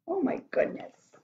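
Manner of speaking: ordinary delivery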